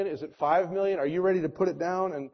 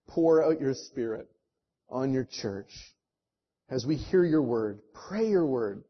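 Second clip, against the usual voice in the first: earnest prayer; 4 semitones lower than usual. Real, not acted. Pastor leads closing prayer.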